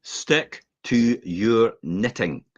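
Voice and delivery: drawn out word by word, very slow; slow, deliberate emphasis. Real, not acted. Emphatic repeat of the saying.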